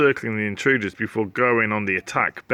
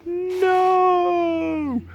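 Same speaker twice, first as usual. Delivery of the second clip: far higher alarmed no; high cry. Acted. Slime crying out as his friend falls. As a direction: yelp in alarm.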